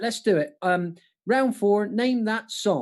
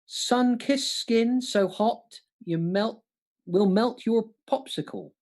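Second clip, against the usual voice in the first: slow, drawn-out reading of the lyric; slow deliberate recital; slower than usual. Shown as a demonstration. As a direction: stretch every word of the quote out. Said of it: "Name that song".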